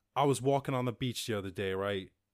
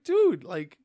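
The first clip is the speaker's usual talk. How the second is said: telling off; 9 semitones higher, 9 dB louder than usual. Retold or imitated. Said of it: "'Cause I was like".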